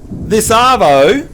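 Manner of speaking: slow Australian slang; Aussie accent. Shown as a demonstration. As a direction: stretch the vowels like an Aussie. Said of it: "honorary Aussie".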